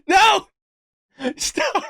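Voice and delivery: high-pitched cry dissolving into laughter; squealed, laughing disbelief; helpless laughing protest. Real, not acted. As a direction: beg it to stop while cracking up. Cracks up at the news.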